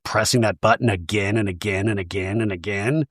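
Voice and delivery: ordinary talk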